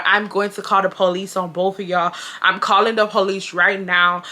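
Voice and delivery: usual speaking voice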